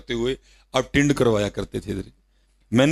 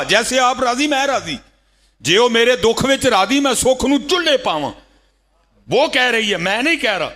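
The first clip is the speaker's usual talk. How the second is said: high, loud devoted plea; fervent preaching; 13 semitones higher, 4 dB louder than usual. Acted. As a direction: plead with full devotion, loudly.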